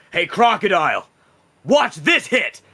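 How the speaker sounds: loud, high show-off yell; taunting battle shout. Acted. Zoroff to Croc before swinging his axe, shouting. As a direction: holler the challenge across a fight. Character calls out before attacking.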